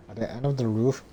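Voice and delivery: ordinary delivery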